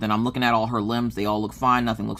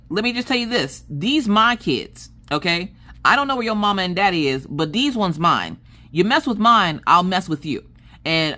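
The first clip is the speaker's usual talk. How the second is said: full mama mode; 6 semitones higher than usual. Retold or imitated. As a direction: lay down the law to a bully. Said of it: "full mama mode".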